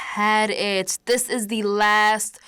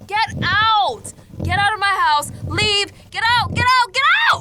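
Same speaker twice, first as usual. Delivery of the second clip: much higher and louder, rising each time; furious scream; 10 semitones higher, 7 dB louder than usual. Acted. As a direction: scream them out of your house. Screaming at the presence.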